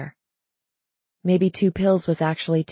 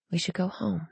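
very quiet, gentle suggestion; soft-spoken, much quieter than usual; softly; 10 dB quieter than usual. A narrator's reading. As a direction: suggest it in a hushed voice. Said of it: "she said softly".

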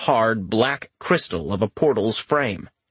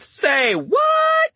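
shocked outburst. Retold or imitated. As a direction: blurt it out in disbelief. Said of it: "the other part wanted to go with".

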